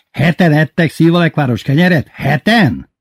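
high, loud disbelief; incredulous teacher. A narrator's reading. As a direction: repeat it as if unbelievable. (hitetlenkedő, hangos kérdés)

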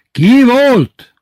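very high, loud roar; yelled question. A narrator's reading. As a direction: lose patience and bellow. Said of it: "A harmadik kérdést már ordította Titi". (kitörő ordítás)

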